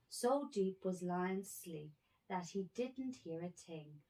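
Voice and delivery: usual speaking voice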